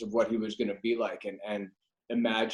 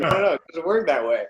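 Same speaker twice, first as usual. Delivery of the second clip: fast, raised mock insistence; mock exasperated protest; 6 semitones higher, 9 dB louder, faster than usual. Retelling being told no, fast raised protest. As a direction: brush off the idea, quick and loud.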